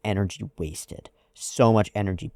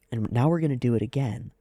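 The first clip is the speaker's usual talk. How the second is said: ordinary delivery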